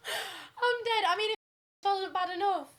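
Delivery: high-pitched I'm dead; amused disbelief. Real, not acted. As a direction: squeal it in disbelief. Laughing at absurd story.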